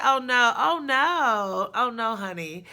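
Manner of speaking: loud, high sassy "oh no, honey"; mock scolding, very loud; sassy retort. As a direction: dismiss him with attitude. Retorting to boyfriend, mocking.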